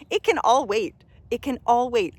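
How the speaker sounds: loud, high, firm repetition; emphatic insistence. Real, not acted. Coach insisting everything else can wait, loud and firm. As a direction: drive the point home hard.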